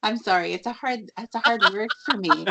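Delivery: giggling through the words; laughing apology. Real, not acted. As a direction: laugh at your own tongue-tied slip, breaking into giggles. Laughing at own stumble over name.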